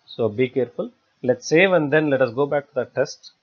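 ordinary talk, nothing marked

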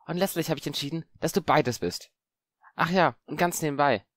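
lightly voiced cheeky boy; a boy complimenting with a grin; boy Harry, amused.

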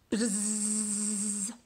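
loud buzzing bee sound. Shown as a demonstration. Teacher does the bee part of the song, buzzing. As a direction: buzz like a busy bee. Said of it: "yell out the bee part".

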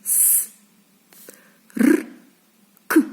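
bare "С, р, к" sounds, long pause between each; isolated consonants. Shown as a demonstration. Teacher listing consonants for children, "С, р, к" each sounded alone. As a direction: say "С, р, к" as sounds, without letter names. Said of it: "Скільки в нас приголосних звуків?".

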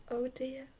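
hushed little oh dear; whispered worry. A narrator's reading. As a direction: whisper it, biting your lip. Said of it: "bit her lip and whispered".

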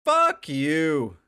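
loud, very high-pitched outraged yell; high, drawn-out shout of rage at the game; real angry yell. Real, not acted. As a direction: yell it furiously, stretching the words out. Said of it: "I'm so mad".

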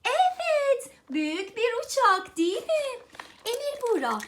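normal talking voice

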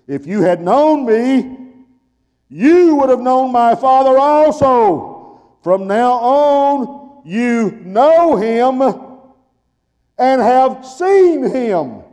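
high, loud, very slow shout; preaching climax. Acted. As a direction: stretch each word out to the church.

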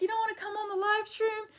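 high, quick mock-pleading quote; teasing quote. Retelling how she teased her boyfriend, high and quick. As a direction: tease him with a bright, fast, high voice. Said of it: "I'm like".

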